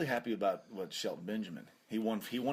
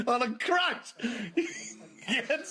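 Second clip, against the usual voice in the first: laughing; 13 semitones higher, 4 dB louder, much slower than usual. Real, not acted. Both laughing loudly.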